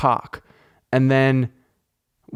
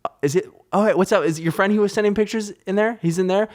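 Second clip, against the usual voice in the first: higher, casual nice-guy questions; feigned friendliness; 8 semitones higher, faster than usual. Retold or imitated. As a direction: play it nice and innocent while secretly fuming. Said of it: "in a nice way".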